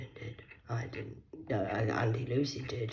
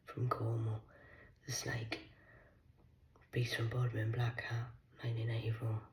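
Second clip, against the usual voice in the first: whisper; 6 dB quieter than usual. Real, not acted. Whispering defiant boasts to unseen viewers. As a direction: hush it all, breathy threat. Long whispered rant to camera.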